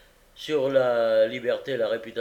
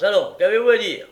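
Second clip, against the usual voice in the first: loud, high, fast and curt; impatient magistrate; 8 semitones higher, 11 dB louder, faster than usual. Acted. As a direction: hurry the witness along.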